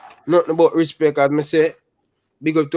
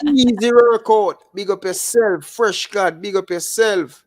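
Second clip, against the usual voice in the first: high-pitched hype greeting; big up a viewer with raised voice; drawn-out, high celebratory shout-out; excited shout-out; 8 semitones higher, 4 dB louder than usual. Real, not acted.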